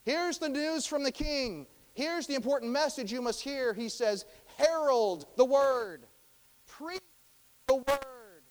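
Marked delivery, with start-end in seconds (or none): 0.0-3.7 high, loud town crier's proclamation; town herald. Acted. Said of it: "like a herald who goes through the town". 4.6-8.4 loud, high herald's cry; slow, trumpet-like proclamation with pauses; proclaiming. Acted. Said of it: "preach means to proclaim, like a trumpet".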